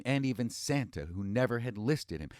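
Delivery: ordinary delivery, nothing marked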